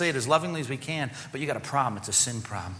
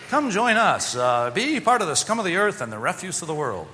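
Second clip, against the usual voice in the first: mock sales pitch; 4 semitones higher, 4 dB louder than usual. Mock gospel tract pitch.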